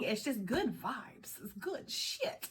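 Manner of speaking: slow breathy whisper of good vibes. Real, not acted. Tarot reader whispers good news to her viewers. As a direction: hush it out slowly like a secret.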